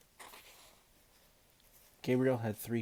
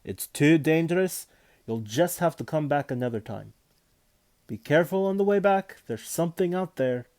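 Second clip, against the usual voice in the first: loud, higher urgent warning; 5 semitones higher, 8 dB louder than usual. Acted. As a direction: warn them off urgently. Warning boys of danger.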